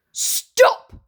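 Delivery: sharp angry stop; angry cry. Acted. Cinderella interrupts the fairy, angry. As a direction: bark it out. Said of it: "cried an angry voice".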